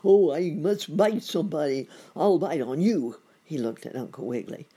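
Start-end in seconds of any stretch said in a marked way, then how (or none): 0.1-3.2 loud, higher-pitched storybook monster; threatening gator in pain; wailing alligator. A narrator's reading. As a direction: cry out in hammed-up toothache misery. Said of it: "cried the alligator".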